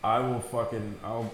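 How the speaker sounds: normal talking voice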